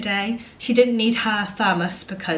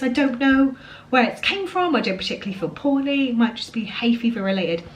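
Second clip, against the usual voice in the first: normal talking voice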